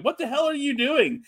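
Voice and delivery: high-pitched mock yell; Michael Cole yelling. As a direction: imitate an outraged commentator, pitch jumping way up. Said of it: "Michael Cole is yelling at him".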